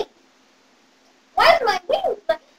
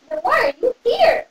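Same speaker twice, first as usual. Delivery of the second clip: ordinary talk, nothing marked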